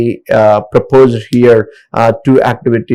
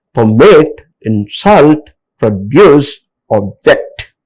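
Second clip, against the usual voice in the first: "permit, insult, produce, object", each strong on its second syllable; word by word, land on the last syllable of "permit, insult, produce, object"; stress on second syllables; slower than usual. Shown as a demonstration. Teacher listing verbs for learners, each stressed on second syllable. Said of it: "a second syllable is stress".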